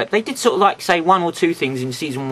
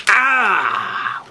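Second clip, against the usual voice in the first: drawn-out higher "aah" of pretend agony; mock pain cry; 5 semitones higher than usual. Reviewer voicing the toy's split, mock pain cry. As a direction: cry out for the toy being pulled apart. Acts out toy being split apart.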